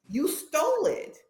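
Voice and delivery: high, slow accusing jab; retold accusation. Confronting her husband about the stolen car, high and slow.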